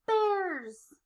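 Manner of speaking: plain reading voice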